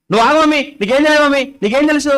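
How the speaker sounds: mock defiant kid talking back; rebellious child. Child dismissing mother, mock defiant backtalk. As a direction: snap back at mum like a cheeky child. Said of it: "అని ఇలా అంటున్నారా పిల్లలు".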